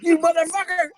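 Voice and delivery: high-pitched hammed-up cursing; mock rage. Co-host imagines Slapdog stunning a kid, mock rage.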